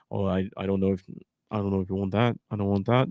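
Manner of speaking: a touch of Brummie gangster; Peaky Blinders accent. Pretend. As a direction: play Tommy Shelby, lightly. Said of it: "Kyle wants a two-announcer booth, both accents".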